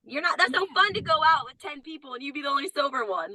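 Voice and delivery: ordinary talk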